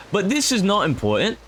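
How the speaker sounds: high, mock British proclamation; British accent. Player carries on the speech to followers, mock British accent. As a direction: declare it in a high-pitched British voice. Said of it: "Just don't ask why I'm British".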